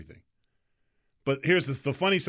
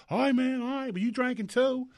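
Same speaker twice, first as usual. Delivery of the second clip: put-on Southern accent; 10 semitones higher than usual. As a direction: lightly mimic a Southern buddy. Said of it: "He's got a real Southern accent".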